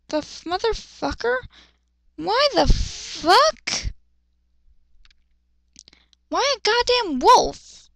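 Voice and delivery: can't believe what the fic just did; baffled disbelief. Real, not acted. Reader baffled by the random wolf, slow and high.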